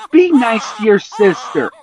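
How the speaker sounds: high, loud mother; defending the baby; scolding shout. A narrator's reading.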